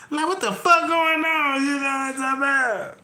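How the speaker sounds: high, squealing voice straight out of a laugh; laughing to tears. Real, not acted. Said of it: "I'm in tears".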